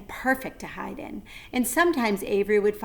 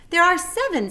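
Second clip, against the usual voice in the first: higher, proud goose; 10 semitones higher, 8 dB louder than usual. A narrator's reading. Goose telling Wilbur about her goslings, higher. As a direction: announce it proudly.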